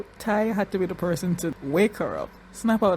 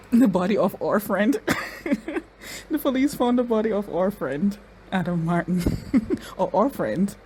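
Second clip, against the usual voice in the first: giggling between the phrases; amused laughing; 3 semitones higher than usual. Real, not acted. Viewer tickled by "our friend", laughing. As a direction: lift the words, breaking into laughter.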